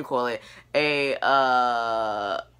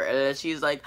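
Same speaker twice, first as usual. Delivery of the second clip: ordinary talk, nothing marked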